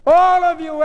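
high, loud, long-held bellow; shout. A narrator's reading. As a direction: shout at the whole room. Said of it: "Epstein shouted".

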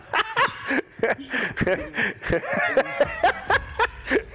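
long high-pitched laughing fit; real laughter. Real, not acted. Shot by a teammate in game, laughing. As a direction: laugh hard and helplessly.

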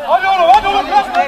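loud, high, insistent yells; urging shout. Real, not acted. Player urging his teammate on, shouting.

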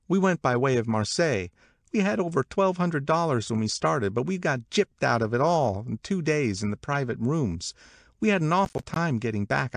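high, lively gossiping woman; gossipy Catherine. Acted. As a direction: pitch it up like eager party gossip. Voices Catherine's travel story.

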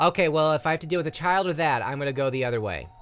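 fast nervous talk; scared and rushed. Real, not acted. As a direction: gabble it while backing away. Hurrying away scared.

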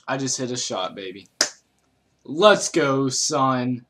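loud celebratory cheer; excited. Real, not acted. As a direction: hype it up after a great shot. Celebrates a shot in game.

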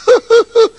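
loud high cackle; high mocking laugh. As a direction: cackle at the fool. Brothers laugh.

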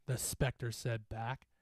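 ordinary delivery

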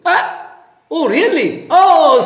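high sudden cry of realization; surprised. Acted. Villagers realize the necklace is up in the tree, surprised. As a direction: gasp at the discovery.

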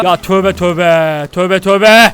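slow, low, stretched exclamation; drawn-out disbelief. Real, not acted. Gamer reacting to the strange scene, slow low disbelief. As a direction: stretch out each word in low dismay. Startled muttering at strange sight.

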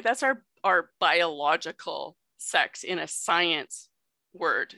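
ordinary talk, nothing marked

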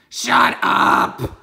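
very loud dragon roar. Acted. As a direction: blast it at the boy. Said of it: "roared the dragon".

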